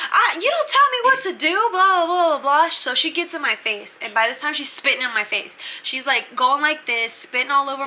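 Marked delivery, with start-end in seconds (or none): 0.1-2.7 very high, loud mocking imitation; hammed-up high-pitched tirade; mock angry yelling. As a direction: squawk it like an outraged bully. Bunkie yelling in retold quarrel.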